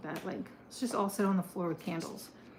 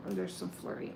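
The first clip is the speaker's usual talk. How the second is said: whispered aside; 13 semitones lower, 8 dB quieter than usual. Real, not acted. Aside while shuffling cards.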